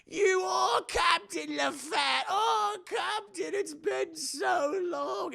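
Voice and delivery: put-on high, slow, overjoyed old rat; hammed-up tearful reunion voice; ancient cabin boy. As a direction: wail with joy at a long-lost captain. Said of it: "He's like".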